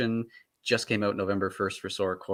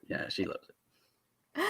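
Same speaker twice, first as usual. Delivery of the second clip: slow soft whisper; 3 semitones lower, 10 dB quieter, much slower than usual. Real, not acted. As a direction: breathe it out slowly. Repeats line in a whisper.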